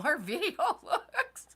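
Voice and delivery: breaking into laughter mid-sentence, voice rising; laughing. Real, not acted. Host happy with how the video looks, laughing as she speaks. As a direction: say it through a rising, delighted laugh. Pleased hosts joke about their video.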